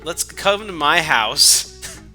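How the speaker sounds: ordinary talk, nothing marked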